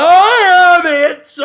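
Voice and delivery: very high, loud, drawn-out yell; in-your-face shout. Acted. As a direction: holler it slowly into a stranger's face. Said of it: "Like that".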